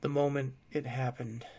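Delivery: usual speaking voice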